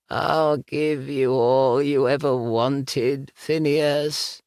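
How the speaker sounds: high, slow croaking voice; croaking ghost voice. Acted. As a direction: croak it out like a dead woman. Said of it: "the voice croaked".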